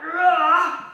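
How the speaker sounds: a sudden loud angry "Rah!"; sudden angry roar. Retold or imitated. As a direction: burst out with a snarling roar. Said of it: "suddenly they're like".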